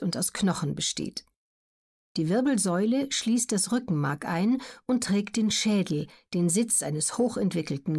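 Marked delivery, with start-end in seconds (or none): none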